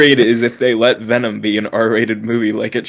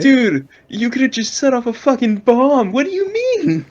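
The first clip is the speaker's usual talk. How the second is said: mock outrage; 12 semitones higher than usual. Mocking movie character.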